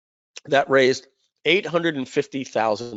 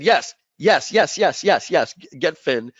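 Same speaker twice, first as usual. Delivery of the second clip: rapid, eager, higher string of yeses; excited yes; 3 semitones higher, slower than usual. Retold or imitated. On the phone with partner, jumping at the news, excited. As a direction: jump at the offer, thrilled.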